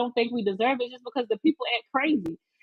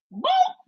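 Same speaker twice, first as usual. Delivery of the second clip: boom. Retold or imitated. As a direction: shout the kick like a sound effect. Imitating the kick sound.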